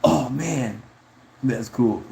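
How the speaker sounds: light imitation of a friend's reaction; mildly put-on impressed reaction; Rico. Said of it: "I could just see Rico like".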